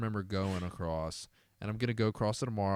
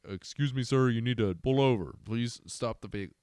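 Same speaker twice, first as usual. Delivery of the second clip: loudspeaker cop; 3 semitones higher, 6 dB louder than usual. Retold or imitated. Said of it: "a police officer uses their loudspeaker".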